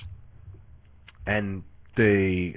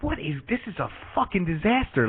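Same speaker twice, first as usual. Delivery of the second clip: exasperated; 7 semitones higher than usual. Retold or imitated. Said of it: "I'm really upset at this point".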